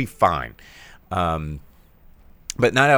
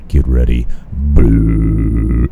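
much lower, loud radio DJ voice; Howard Stern on air; 8 semitones lower, 8 dB louder, slower than usual. Retold or imitated. Imitating Howard Stern in Private Parts in a loud low DJ voice. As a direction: boom it like a shock jock. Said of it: "like Howard Stern in Private Parts".

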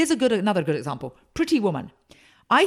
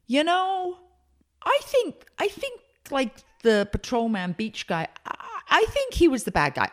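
high, far-off dopey voice, much higher; silly confused moviegoer; 6 semitones higher than usual. Retold or imitated. Mocking a moviegoer who backs the wrong guy. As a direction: play a confused fan getting it all wrong.

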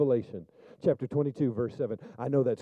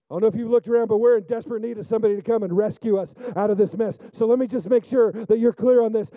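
higher and louder, soaring sermon voice; preaching at full force; 10 semitones higher, 7 dB louder than usual. Acted. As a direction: preach it loud and fervent to the congregation.

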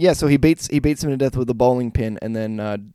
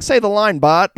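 high, loud egging-on voice; mock urging; 9 semitones higher, 6 dB louder than usual. Host pressures friend to say the line, high and loud. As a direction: cheer him on like a crowd chant. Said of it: "it's like".

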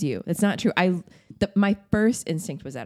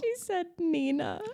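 high fake crying "she said Nina"; mock wail; 10 semitones higher, 7 dB quieter than usual. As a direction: pretend to cry over it. Teasing mock cry.

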